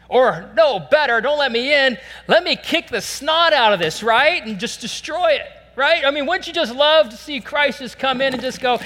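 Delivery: loud, high, driving preacher voice; fervent preaching. Acted. Pastor urging the congregation, fervent loud preaching. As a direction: drive it home to the church.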